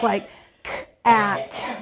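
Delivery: K and AT said as separate sounds; tapped-out sounds. Shown as a demonstration. Teacher showing how to tap individual letters, sounds separated. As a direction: break it into "k", then "at". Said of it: "tapping individual letters".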